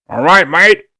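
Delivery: put-on pirate-flavoured Australian accent. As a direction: growl it like an Aussie pirate. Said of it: "that Australian, but with the pirate vibe".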